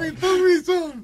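high falsetto. Pretend. High put-on voice.

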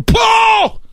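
shouted high-pitched smack; blow sound. Acted. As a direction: voice a hit landing, explosively. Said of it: "le pega otra vez". (golpe imitado a grito agudo)

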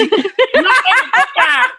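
high, slow mock vendor voice, laughing; mimicking the taquero. Teller mimics taquero refusing a coke, laughing. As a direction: play the deadpan taquero, cracking up.

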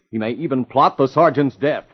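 ordinary talk, nothing marked

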